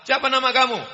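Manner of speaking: high, loud, fast grilling of the suitor; father-in-law sizing up the suitor, loud and high; stern interrogating father. Retold or imitated. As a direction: bark the question like a suspicious father-in-law.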